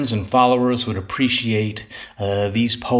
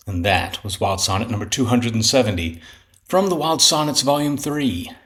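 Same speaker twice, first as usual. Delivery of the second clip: ordinary delivery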